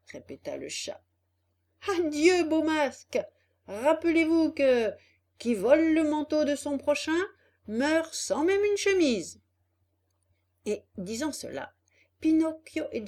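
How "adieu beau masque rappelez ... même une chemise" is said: high, loud, lecturing rebuff; acted puppet's defiant send-off; scornful farewell. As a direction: proclaim the proverb as a parting jab. Puppet scornfully rejects them again.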